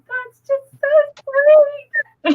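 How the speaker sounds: very high, squeaky imitation of weeping; mock tearful. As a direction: act out a hammed-up crying wail over something sweet. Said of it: "Why are you crying".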